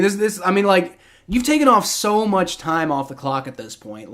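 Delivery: ordinary talk, nothing marked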